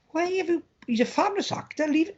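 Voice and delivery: high-pitched retold plea; plead, as if thinking aloud; exasperated protest. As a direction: pitch it right up in mock dismay. Retold exasperated thought about miscasting.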